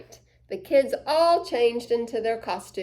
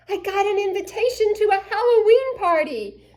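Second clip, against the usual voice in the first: excited; 9 semitones higher, 7 dB louder, faster than usual. Acted. Charlie excited about invitation.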